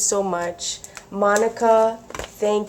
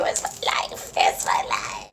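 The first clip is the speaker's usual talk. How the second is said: whispered aside. Real, not acted. Loves tissue paper.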